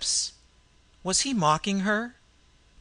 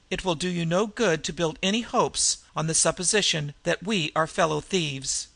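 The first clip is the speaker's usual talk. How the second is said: plain reading voice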